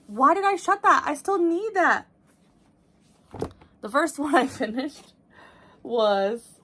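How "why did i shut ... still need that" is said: sudden high, loud panic; surprised. Real, not acted. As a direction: caught off guard by her mistake. Startled, app closed by mistake.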